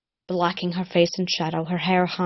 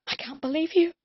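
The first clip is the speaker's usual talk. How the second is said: higher, voice catching; wavering voice; 11 semitones higher than usual. Acted. Cullen to Rune, higher wavering voice. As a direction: let the voice waver with tears. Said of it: "Her voice wavered".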